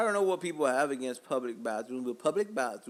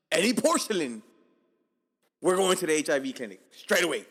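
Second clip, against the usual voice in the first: loud, comically horrified declaration; emphatic outrage; 2 semitones higher, 10 dB louder than usual. Real, not acted. Comedian refusing to touch public toilet porcelain, loud horrified outrage. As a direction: belt it out as a firm warning.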